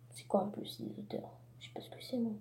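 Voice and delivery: unvoiced, very soft puzzled whisper; whispered aside. Real, not acted. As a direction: whisper it to yourself, confused. (chuchotement perplexe)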